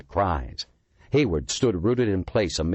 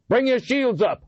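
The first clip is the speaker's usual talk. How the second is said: shouted order; 4 dB louder than usual. A narrator's reading. Barked order under attack.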